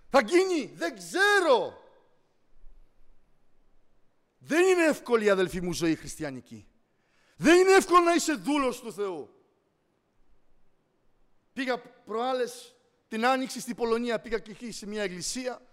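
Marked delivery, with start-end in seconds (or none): 0.1-9.3 loud, higher and slowed calling out; preacher crying out; fiery preaching. Acted. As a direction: cry out to the congregation. Preaching at full force.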